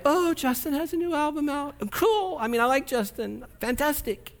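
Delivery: high-pitched, lightly gushing fan; mock fan excitement. Preacher imitating fans of a new album, mock excited. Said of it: "get this excited over [name]".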